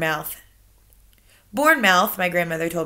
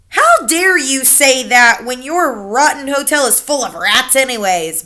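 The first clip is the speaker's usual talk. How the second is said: indignant cry; 5 semitones higher, 8 dB louder than usual. Acted. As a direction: scold the manager. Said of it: "my grandmother cried".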